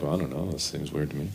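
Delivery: soft, low doubtful mutter; a hint of puzzled [name], half to himself; unsure [name]. [name] unsure, voiced softly.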